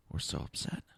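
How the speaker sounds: mock soft voice. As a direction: fake concern, hushed. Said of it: "it was soft".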